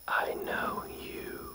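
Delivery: slow, voiceless breathy whisper. Retold or imitated. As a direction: breathe each word slowly like a spirit. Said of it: "It's like a breathy whisper".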